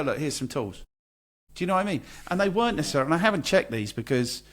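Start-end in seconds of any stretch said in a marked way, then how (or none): 0.0-0.8 low, offhand chatbot voice; dismissive robotic brush-off; smug assistant voice. Retold or imitated. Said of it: "it would just go".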